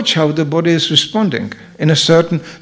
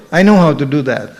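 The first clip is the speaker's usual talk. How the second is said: ordinary delivery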